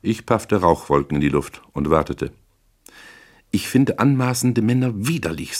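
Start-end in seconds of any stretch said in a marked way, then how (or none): 3.5-5.6 high-pitched, haughty disgust; disgusted scorn. Acted. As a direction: spurn him in a raised-pitch character voice. Client scorns the detective in quarrel.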